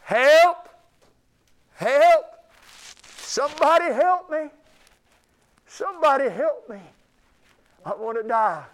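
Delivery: desperate screams for help, high and loud; fluttering cries for help. Shown as a demonstration. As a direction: scream for help as if trapped in a fire. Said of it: "you do like this".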